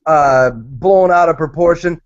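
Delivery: normal talking voice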